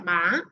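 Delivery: "ma" gliding upward; Mandarin second tone. Shown as a demonstration. Teacher showing the second tone, rising glide. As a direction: lift the pitch through "ma" like a question. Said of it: "es un tono que asciende".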